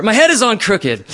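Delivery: loud, high furious complaint; replayed anger, loud and high; angry. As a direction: rage at your own body, loud and high. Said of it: "moved right into anger".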